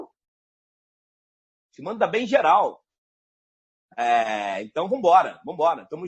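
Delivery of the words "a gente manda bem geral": slow, drawn-out, each word weighed; slow emphatic repeat. Shown as a demonstration. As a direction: stretch it out for emphasis.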